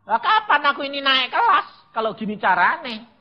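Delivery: high, loud complaint; exasperated protest. Retold or imitated. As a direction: protest to God like a fed-up student. Memerankan protes mahasiswa kepada Tuhan. (protes kesal dengan suara tinggi)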